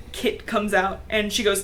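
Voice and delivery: normal talking voice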